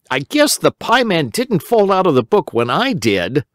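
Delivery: a hint of a sad little boy; Simple Simon. A narrator's reading. Simon telling Uncle Wiggily the pieman is lost, lightly boyish.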